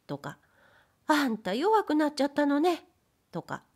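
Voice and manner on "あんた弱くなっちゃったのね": higher, mocking pout; wife teasing her husband. A narrator's reading. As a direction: needle him playfully. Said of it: "まだふざけ足りないと見え".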